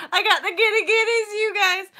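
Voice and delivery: high, loud giddy squeal. Real, not acted. Vlogger confessing the giggles to viewers, giddy squeal. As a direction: squeal it out, overcome with giddiness. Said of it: "I've got the giddy giddies".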